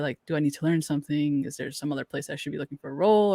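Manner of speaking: ordinary talk, nothing marked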